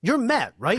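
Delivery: high, eager fan asking; mock fan voice. Diner at the next table recognizing the host, high and eager. Fans recognizing him at dinner.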